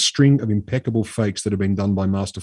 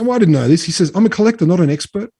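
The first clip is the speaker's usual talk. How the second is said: high, put-upon voice of a shifty collector; whiny Ruffini; 7 semitones higher than usual. Retold or imitated. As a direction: play the innocent middleman, pitched well up. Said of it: "He was like".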